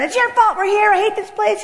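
high-pitched retold nagging; acted wife's complaint; blaming.

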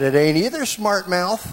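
loud, high retort; re-enacted screaming in an argument; screaming comeback. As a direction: yell it back at him.

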